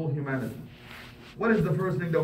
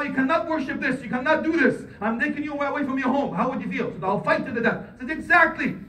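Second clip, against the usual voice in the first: raised, pressing sermon voice; impassioned preaching; 9 semitones higher than usual. Acted. Imam preaching hard to the congregation, impassioned.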